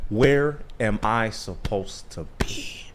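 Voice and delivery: slow, soft mock despair; Cartman. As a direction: let it sink into a hushed, drawn-out question. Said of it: "he's talking to his mom".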